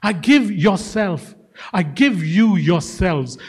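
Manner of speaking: slow savouring. Real, not acted. Preacher savours the quote slowly.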